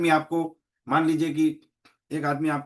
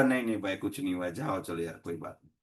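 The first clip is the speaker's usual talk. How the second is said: angry rider cooling off, fading much softer and lower; calmed down; 10 semitones lower, 7 dB quieter than usual. Retold or imitated. Rider calms down after a smiling apology, softening to a murmur. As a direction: let the anger drain out, voice sinking away. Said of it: "तो एकदम ठंडा हो जाएगा".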